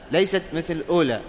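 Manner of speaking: usual speaking voice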